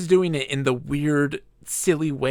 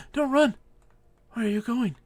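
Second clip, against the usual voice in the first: high plea; 8 semitones higher than usual. Real, not acted. Pleading with fleeing unicorn.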